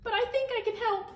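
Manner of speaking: high, bright sweet greeting; bright old woman. Acted. Old woman greets Mister Cream brightly, high-pitched. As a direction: chirp it sweetly like a kind granny. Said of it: "she said brightly".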